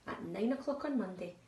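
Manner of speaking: ordinary delivery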